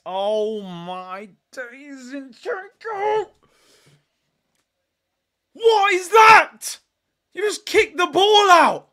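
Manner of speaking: very high, slow, drawn-out shout of disbelief; can't believe what he just saw; stunned outrage. Real, not acted. Fan howling at Zinchenko's kick out.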